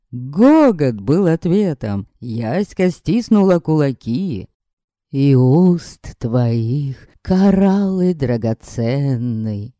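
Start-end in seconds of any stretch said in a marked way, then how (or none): 5.1-9.7 slow, pompous verse recital; lovesick declaiming. Acted. Vampire declaiming verse at Yaska, slow and pompous. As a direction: recite it slowly like a bad poet.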